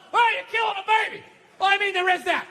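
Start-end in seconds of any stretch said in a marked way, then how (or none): 0.1-1.2 fast yelled accusation; quick mock-outraged shout; mock heckler yell. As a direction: bark it out like an outraged protester. Mock pro-life heckler yelling.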